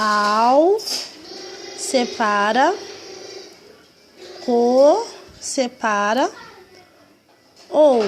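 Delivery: "Ao, separa, co, separa, ou" each syllable split and drawn out, rising; syllables spelled out. Shown as a demonstration. Teacher spelling álcool syllable by syllable for children. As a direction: sound out "Ao", "co", "ou" one by one, pausing between. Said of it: "vamos separar aqui a palavra álcool".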